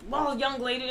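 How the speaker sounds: scolding father figure. Retold or imitated. Co-host mimicking Giles lecturing Buffy, mock stern. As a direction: imitate a lecturing parent. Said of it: "when he gets all like".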